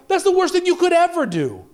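ordinary talk, nothing marked

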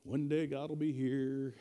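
slow, low, mock-dreamy voice; drawn-out, softened mock wistfulness; passive hopeful waiter. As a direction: imitate someone idly waiting and hoping. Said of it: "stand there like this, just hoping".

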